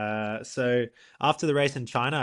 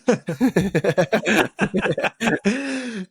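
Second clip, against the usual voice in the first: big laugh; 6 semitones higher, 7 dB louder than usual. Real, not acted. Laughs at hospital joke.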